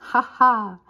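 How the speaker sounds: loud, acted belly laugh; bursting out laughing. A narrator's reading. Bison amused by Billy's boast, acted laughter. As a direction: laugh out heartily as the beast. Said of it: "Le bison éclate de rire".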